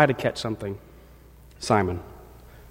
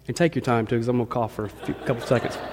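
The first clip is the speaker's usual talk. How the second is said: softer, lower side remark; quiet aside; 4 semitones lower, 6 dB quieter, faster than usual. Real, not acted. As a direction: murmur it aside. Aside while stepping away.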